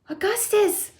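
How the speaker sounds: high-pitched motherly cry. A narrator's reading. Mother calls her son, high cry. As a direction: call high to your child. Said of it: "cried Missus Gloop".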